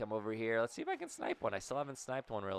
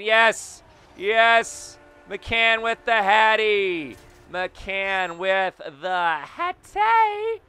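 loud, slow victory cheer, higher; excited shout; 8 semitones higher, 11 dB louder, much slower than usual. Real, not acted. Gamer celebrating his player's hat trick with a loud cheer.

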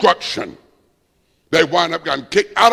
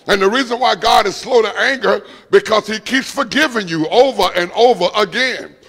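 raised, driving preacher voice; preaching at full force; 3 semitones higher than usual. Acted. Preacher rousing the congregation about grace, full-force preaching. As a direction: lift the room and press every line.

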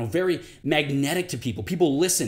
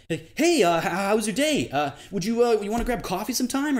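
higher, louder, stumbling eager ask-out; flustered and trying too hard with a crush; nervous high rapport voice; 7 semitones higher, 3 dB louder than usual. Shown as a demonstration. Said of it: "we accidentally slip into that".

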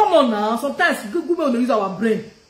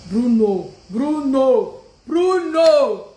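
calling out, building; much slower than usual. Real, not acted. Streamer hails a viewer by name, calling out with growing force.

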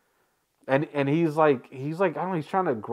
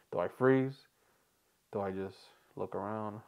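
normal talking voice

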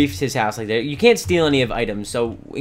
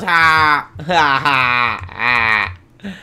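loud "ha ha" jeer, falling away; gloating forced laugh; mocking laughter; 7 dB louder, much slower than usual. Laughs at the fallen sheep.

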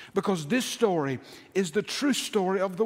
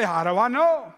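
raised, louder protesting question; doubting listener's challenge; 4 semitones higher, 5 dB louder than usual. Acted. Listener questions the preacher, raised and challenging. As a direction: throw the question back, high and loud.

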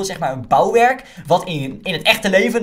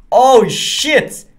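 higher, louder startled cry; startled exclamation; 7 semitones higher, 10 dB louder than usual. Real, not acted. Armor switches on by accident, startled loud outburst. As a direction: caught off guard, blurt it out.

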